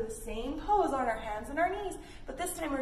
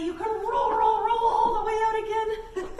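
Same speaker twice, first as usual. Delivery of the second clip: high, loud rolling chant; bouncy cheerful cue, loud and high; excited sing-song; 10 semitones higher, 7 dB louder than usual. Shown as a demonstration. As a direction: cheer the kids through the roll.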